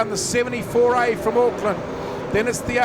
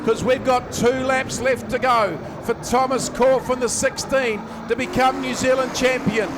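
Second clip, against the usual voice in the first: ordinary talk, nothing marked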